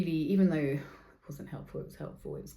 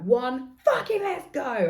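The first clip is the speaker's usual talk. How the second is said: mock rage; 7 semitones higher, 9 dB louder, slower than usual. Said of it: "I'm just like".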